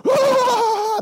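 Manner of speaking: very high, loud mock shriek; mock crowd shriek. Pastor imitating a congregation erupting, high loud shriek. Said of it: "and everybody goes".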